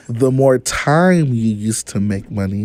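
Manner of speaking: slow, deliberate restatement; slow repeat. Shown as a demonstration. Guest repeats his money rule for the host on request, slowly. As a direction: spell it out slowly, phrase by phrase. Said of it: "Say it again, but say it slow".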